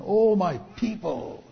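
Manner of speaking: loud, high cry of exasperated disappointment; God lamenting over his people, acted out; disappointed lament. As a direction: draw it out slowly, pleading with wayward people. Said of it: "the heart of God being disappointed".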